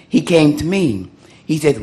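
ordinary talk, nothing marked

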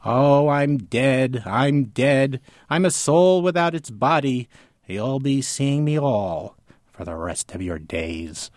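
high, drawn-out cawing ghost voice; eerie cawing dummy voice; crow-like cawing ghost. Acted. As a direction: caw it out like a dead crow spirit. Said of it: "a crow-like cawing from the blue spotlight".

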